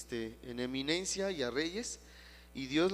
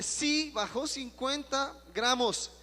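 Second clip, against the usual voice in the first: mock amazed announcement; 9 semitones higher, 4 dB louder, slower than usual. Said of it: "la interventora dice".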